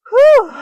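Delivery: sudden high-pitched whoosh of breath; loud high whew. Real, not acted. Exhale after pose.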